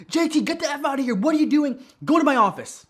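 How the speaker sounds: high, loud outburst at an employee; angry boss yelling. Acted. Boss throws employee out of the conference room, angry yell. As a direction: bark it out as a furious boss.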